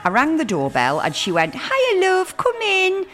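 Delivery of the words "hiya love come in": high-pitched Welsh accent impression; chirpy Welsh lady accent. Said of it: "Did you like my little Welsh accent?".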